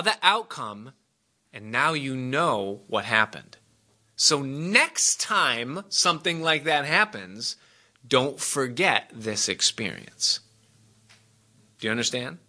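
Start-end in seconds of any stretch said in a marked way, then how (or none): 4.1-10.4 slow, weighty final counsel; emphasis. Shown as a demonstration. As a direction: drive home the point slowly. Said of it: "pastor pressing home the lesson".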